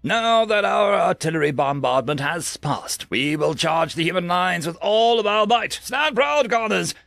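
high, projected commander's address, building in force; rally the troops for a charge; rousing battle speech. Acted. Commander sends conscripts against human lines, rousing and forceful.

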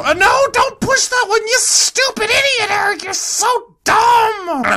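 high mock scolding of himself; high whiny mock telling-off; squeaky self-scolding. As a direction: nag yourself in a high whiny voice.

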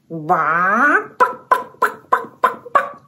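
chicken clucking. Pretend. Presenter imitating a chicken for kids, squeaky clucks. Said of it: "Chicken goes".

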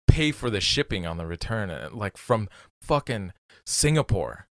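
normal talking voice

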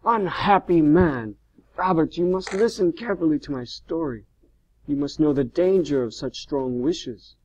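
high, loud, impassioned outburst; crying out. A narrator's reading. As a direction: cry out a sharp, urgent warning. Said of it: "he cried out".

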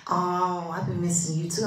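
normal talking voice

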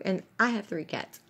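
usual speaking voice

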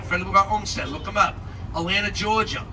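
usual speaking voice

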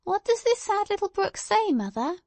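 put-on very high little-girl voice; high-pitched child's question, rising at the end; inquiring child. A narrator's reading. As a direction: ask like a curious small child. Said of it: "inquired she".

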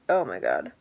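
deep, drained groan of exhaustion; weary groan. Retold or imitated. Vlogger groaning at her own low-energy day, drained and deep. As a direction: let it sink low and tired.

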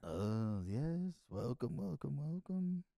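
soft-spoken put-on Israeli accent. Friend performs on request to welcome visitors, put-on Israeli accent. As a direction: greet guests in a mock Israeli accent. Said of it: "Do your Israeli accent".

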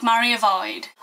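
ordinary delivery

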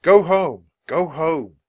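loud, high repeated command; yell. A narrator's reading. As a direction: shout to send the animal away. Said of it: "Jack yelled at the elephant".

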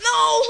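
loud scream of "no" breaking into laughter; high wailing defeat cry; anguished scream. Real, not acted. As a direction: scream it, then crack up. Losing in the game.

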